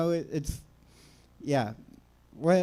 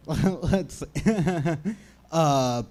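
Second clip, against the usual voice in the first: ordinary talk, nothing marked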